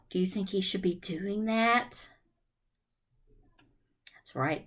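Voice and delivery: ordinary talk, nothing marked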